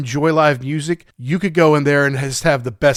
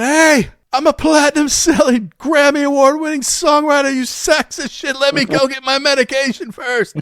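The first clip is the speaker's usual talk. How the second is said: higher, louder mock outburst at the pharmacy queue; mock scream; 10 semitones higher, 3 dB louder than usual. Singer fantasizing yelling in the CVS line, mock shout. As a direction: ham up an imagined yell, pitched high. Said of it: "I just wanna scream out".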